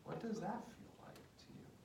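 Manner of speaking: breathy, hushed probing question; near-whispered caring question; soft therapist question. Shown as a demonstration. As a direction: ask it gently to invite him inward. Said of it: "can you slow that down for a minute".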